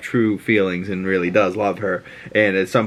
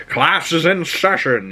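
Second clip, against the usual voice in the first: teacher's mock announcement; 7 semitones higher than usual. Acts out teacher announcing class.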